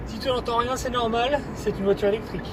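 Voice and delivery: voix haute et moqueuse; high mock voice. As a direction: pitch it up for a joke. Blague, voix aiguë. (voix aiguë pour rire)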